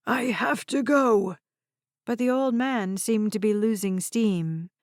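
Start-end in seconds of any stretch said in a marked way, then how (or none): 0.1-1.4 put-on insistent old man. A narrator's reading. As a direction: insist on leaving. Old man losing steam.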